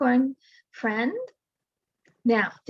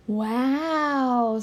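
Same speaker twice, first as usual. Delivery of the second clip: astonished. Acted.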